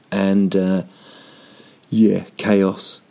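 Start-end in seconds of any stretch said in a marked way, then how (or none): none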